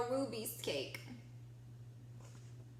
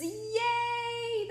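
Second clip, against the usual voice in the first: high singsong yay; bright sung little cheer; excited cheer; 11 semitones higher, 4 dB louder than usual. Real, not acted. Celebrating with children.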